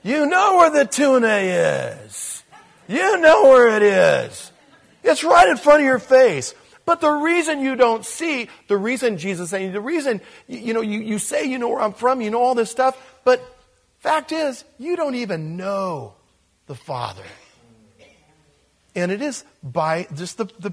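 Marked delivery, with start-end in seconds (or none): 0.0-6.5 high, loud, excited coaxing like calling a cat. Pretend. 6.8-17.2 heated emphasis. Real, not acted. Continues heightened rebuke.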